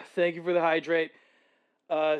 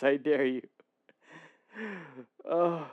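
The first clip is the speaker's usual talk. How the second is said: ordinary talk, nothing marked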